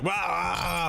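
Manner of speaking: sudden high mock yell of a hyped streamer; hype streamer yell. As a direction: squeal it like an overexcited streamer. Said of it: "I'm not all like".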